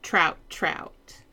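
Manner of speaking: plain reading voice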